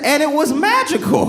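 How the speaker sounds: very high, comic lovestruck cry; gushing insistence. Acted. Besotted man defending one date, hammed-up gushing insistence.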